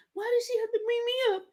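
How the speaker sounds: high, thin put-on voice; mock weepy Mackenzie. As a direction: imitate a girl on the verge of tears. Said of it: "she was like".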